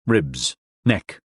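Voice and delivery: ordinary delivery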